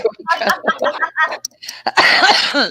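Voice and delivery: breathy laugh. Real, not acted. Laughing at doctor joke.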